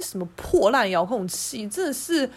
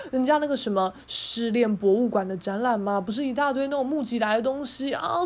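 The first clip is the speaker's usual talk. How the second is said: normal talking voice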